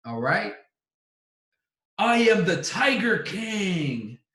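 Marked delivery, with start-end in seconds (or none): 2.0-4.2 slow, higher, boastful proclamation; grand Tiger King boast. Acted. Host proclaims himself Tiger King.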